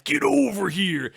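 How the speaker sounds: high, loud, slow growled catchphrase; loud imitation of the game's battle cry; Scorpion impression. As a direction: belt it like the game announcer. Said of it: "you heard that".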